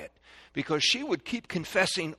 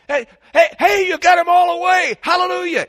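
high, shouted victory cheer; jubilant shout; 14 dB louder than usual. Shown as a demonstration. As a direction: celebrate loudly as the kids finish in time. Celebrating children's finished clean-up.

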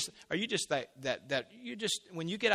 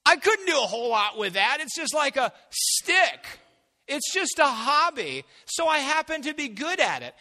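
whiny dismissal; 12 semitones higher, 11 dB louder than usual. Retold or imitated. Listener dismissing their hobby to God, mock whining. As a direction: brush off your own gift with a whine. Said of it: "You say, "What?".

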